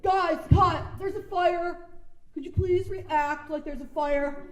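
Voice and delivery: loud, high, then slowing down; an impatient director asking for a reaction; a director telling extras to react to a fire; mock exasperated director.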